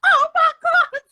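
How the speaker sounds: high, loud delighted outcry; excited gasp. Retold or imitated. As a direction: squeal it, then laugh. Retells her shocked reaction.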